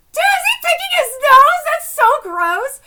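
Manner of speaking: high, loud disbelief; real shocked outburst, easing at the end; incredulous shout. Real, not acted. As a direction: yell it like you can't believe your eyes. Shocked reaction to the manga picture.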